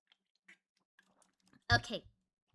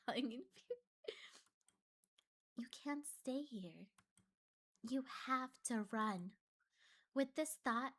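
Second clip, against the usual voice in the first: eerie story narration; 3 semitones lower, 7 dB quieter than usual. Acted. Reads horror game narration.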